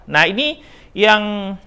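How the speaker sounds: usual speaking voice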